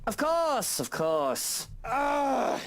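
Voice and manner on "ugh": a high, exasperated ugh; annoyed groan. Acted. Boss groaning at the interruption, exasperated. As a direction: groan like you've been woken too early.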